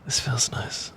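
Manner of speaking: soft intimate whisper; a hushed whisper while holding hands. Acted. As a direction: whisper it close and tender.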